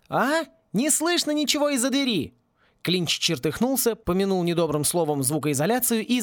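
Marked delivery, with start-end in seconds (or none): 0.1-2.3 calling out. A narrator's reading. Shouting through a door.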